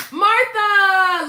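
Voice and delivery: gasping, excited greeting; surprised. Real, not acted. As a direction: call her name with a gasp. Spots friend in chat, gasps.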